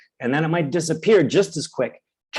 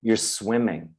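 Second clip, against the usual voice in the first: calm reassurance; 6 semitones lower, 6 dB quieter than usual. Pretend. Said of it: "the resilience says".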